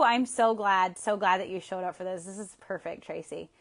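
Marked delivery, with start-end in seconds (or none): none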